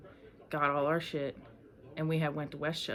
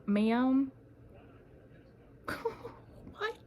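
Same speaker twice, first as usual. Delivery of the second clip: incredulous; 9 semitones higher than usual. Retold or imitated. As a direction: cry out in disbelief. Reacting to alien story.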